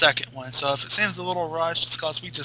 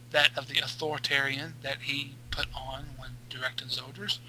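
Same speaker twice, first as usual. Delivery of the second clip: normal talking voice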